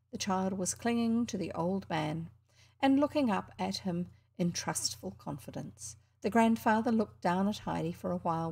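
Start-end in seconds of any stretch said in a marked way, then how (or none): none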